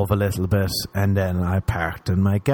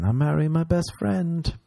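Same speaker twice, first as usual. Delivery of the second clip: the phrase "I'm marrying my best friend" in a higher mocking singsong; 8 semitones higher than usual. Mocking young brides who say it, high and singsong. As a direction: parrot the cliché up high, mocking it. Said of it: "stop with that saying".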